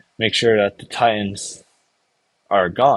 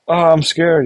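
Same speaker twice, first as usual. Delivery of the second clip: higher-pitched mock fear; mock scared crybaby; 6 semitones higher, 4 dB louder than usual. Recapping Armin's fear for viewers, mock scared. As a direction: play a frightened crybaby, lightly. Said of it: "Armin's a kinda crybaby".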